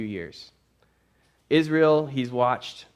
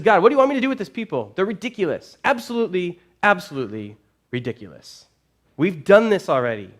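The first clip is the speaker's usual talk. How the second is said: fast, high and loud, fed up; exasperated complaint; 6 semitones higher, 6 dB louder, faster than usual. Retold or imitated. Joshua venting to God about the people, fast exasperated outburst. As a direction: rattle it off in disbelief at the people.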